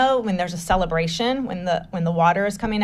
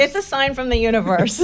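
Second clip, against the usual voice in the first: amused, higher-pitched, laughing echo; laughing agreement; 4 semitones higher than usual. Real, not acted. Storyteller echoing her host's callback, laughing. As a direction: laugh through a happy agreement.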